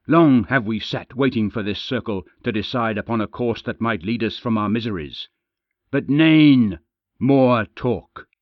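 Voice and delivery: loud, forceful snarling plea. Acted. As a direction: snarl it at the crowd, demanding. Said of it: "with a deep, snarling voice that demanded to be heard".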